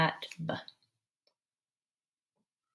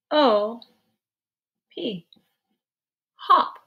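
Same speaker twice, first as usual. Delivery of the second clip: ordinary delivery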